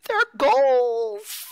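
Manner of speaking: high, loud mock fangirl squeal; gushing fan impression. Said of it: "she's one of those".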